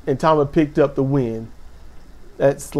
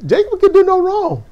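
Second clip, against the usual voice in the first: put-on much higher, squealing fan-girl delight; gleeful outburst; 13 semitones higher than usual. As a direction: blurt it out like a giddy superfan. Repeats line as gleeful outburst.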